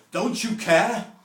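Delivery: high, loud, scolding "Don't you care?"; a factory manager scolding a worker on the cheese line; angry manager. Retold or imitated. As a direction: bark at a slacking worker like a cross boss. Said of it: "the manager said".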